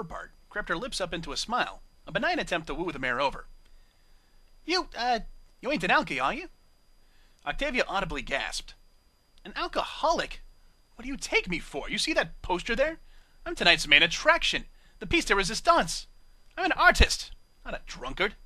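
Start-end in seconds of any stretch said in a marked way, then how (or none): 4.6-6.5 suspicious British barmaid. Acted. Bar mare suspicious of Octavia. 9.4-18.4 indignant. Acted. Octavia offended, indignant.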